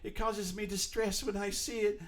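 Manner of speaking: high, slow, mock-fragile whine; mocking whiny protester. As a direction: mimic a thin-skinned protester, drawn out. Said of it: "saying it".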